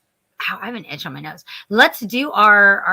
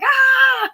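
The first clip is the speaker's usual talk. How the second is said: shrill high excited shriek; high fan scream; 5 dB louder than usual. Retold or imitated. Seeing Robert Englund on screen, in a high excited scream. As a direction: squeal like a starstruck fan. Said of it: "I'm like".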